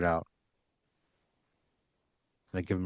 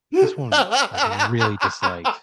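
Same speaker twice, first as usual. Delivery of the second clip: high, slow, laughing through the words; laughing complaint; 14 semitones higher, 3 dB louder, much slower than usual. Real, not acted. Player complaining to Pat about the story, laughing. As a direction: whine it while cracking up. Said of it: "this fucking sucked".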